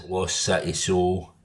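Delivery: usual speaking voice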